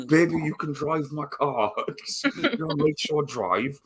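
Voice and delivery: half-sung mock English accent, giggling through it; bad English accent. Parodying [name] at the fence, mock English accent. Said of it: "I can't even do a fucking English accent".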